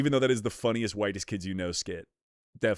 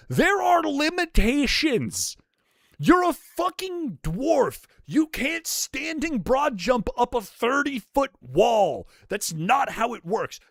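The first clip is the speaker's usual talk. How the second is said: ranting; 14 semitones higher, 4 dB louder, slower than usual. Real, not acted. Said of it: "Now I gotta fucking rant".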